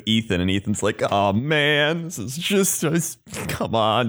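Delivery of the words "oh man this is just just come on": higher, drawn-out mock groaning complaint; put-on exasperated plea, dragged out; mock exasperation. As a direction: whine it like a guy who's had enough. Acting out Ethan's exasperation.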